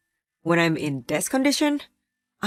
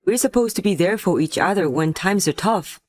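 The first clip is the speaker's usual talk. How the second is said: usual speaking voice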